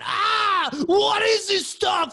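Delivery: high, loud, slow frightened shouting; a tripping man losing control in fear; panicked yell. Retold or imitated. Frantic tripping dude voiced.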